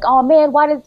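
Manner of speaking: a jealous kid's mock grumble; jealous whine. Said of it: "catch feelings on my sister".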